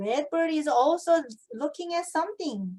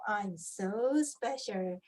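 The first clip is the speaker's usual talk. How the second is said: ant voice; 5 semitones lower, 6 dB quieter, slower than usual. Acted. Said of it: "pointing himself".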